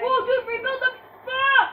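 high, loud urgent yell to a teammate; panicked outburst. Real, not acted. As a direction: cry out in a gaming panic. Urgent panic during the game.

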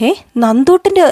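a sudden shocked "what?"; startled gasp. Retold or imitated. Shocked at news of photo. (ഞെട്ടലോടെ ഉയർന്ന സ്വരത്തിൽ)

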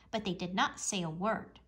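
ordinary delivery

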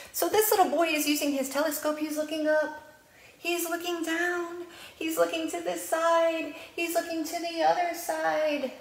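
high, singsong storytime voice; reading to children. Acted. Librarian reading picture book aloud to preschoolers, singsong. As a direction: read it bright and lilting for little ones.

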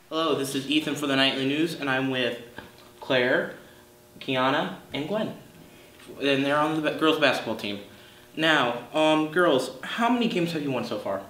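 bright upbeat anchor voice; news anchor. Acted. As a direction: present it like TV news. School news anchor intro.

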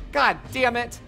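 ordinary talk

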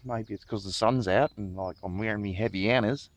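hammed-up Aussie accent rising on the "you know"s. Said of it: "I've just come out with an Aussie accent".

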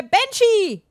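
loud high fed-up yell of Banshee; fed-up streamer shouts at the game. Real, not acted. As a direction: scream the name in frustration. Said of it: "das reicht mir jetzt hier".

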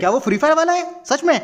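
high, eager disbelief; excited double-check; surprised. Acted. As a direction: ask it high, hardly believing the news. Surprised by news of an ally.

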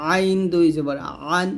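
আইন squeezed from mid-throat, ending আন; pressed ʿayn. Shown as a demonstration. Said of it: "হলকের মাঝখান থেকে চাপ দিয়ে". (আইন হলকের মাঝখান থেকে চাপ দিয়ে)

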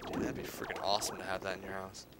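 ordinary talk

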